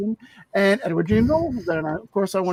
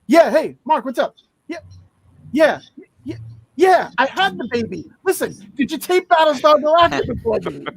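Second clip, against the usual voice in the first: higher, insistent imitation of a new mother; drugged-up wife; 6 semitones higher than usual. Wife phoning Mark after the birth, hammed-up insistence. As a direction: play her impatient to get to what matters. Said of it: "she was all drugged up".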